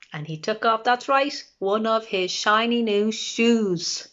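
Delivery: ordinary delivery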